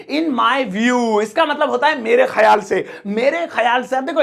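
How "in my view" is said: very drawn-out phrase for learners; slow model for copying; slow teaching repeat. Shown as a demonstration. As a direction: stretch each word out slowly.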